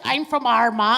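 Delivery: a loud imitation of the man from Armagh; mock Armagh man. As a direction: shout it like the offended Northern Irishman.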